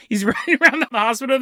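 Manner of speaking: ordinary delivery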